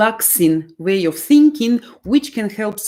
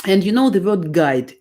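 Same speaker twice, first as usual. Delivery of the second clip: normal talking voice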